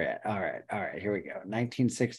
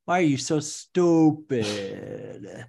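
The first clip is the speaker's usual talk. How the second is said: drawn-out, higher exasperated whine; 5 semitones higher, 4 dB louder, slower than usual. Real, not acted. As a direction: berate a dumb computer, stretching out each "stupid". Berating the search engine in exasperation.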